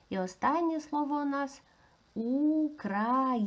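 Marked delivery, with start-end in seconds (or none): 2.1-3.4 УКРАЇНЕЦЬ in slow separate syllables, pitched up; careful, drawn-out syllable demonstration; sounded out. Shown as a demonstration. Teacher sounds out word for analysis.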